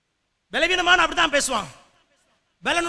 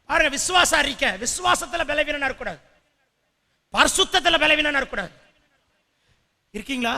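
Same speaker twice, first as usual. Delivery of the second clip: usual speaking voice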